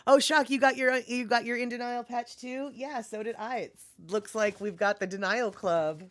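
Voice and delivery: normal talking voice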